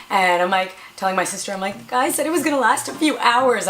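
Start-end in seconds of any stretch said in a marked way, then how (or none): 1.8-3.7 high-pitched wounded complaint; hurt. Retold or imitated. Patient complaining to sister about the numbing, hurt. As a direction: protest it higher, as if let down. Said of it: "I felt, like, really, really kind of hurt".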